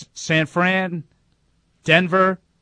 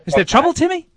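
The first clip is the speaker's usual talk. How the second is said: Lassie owner bit; 8 semitones higher than usual. Retold or imitated. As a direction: play the worried owner in Lassie.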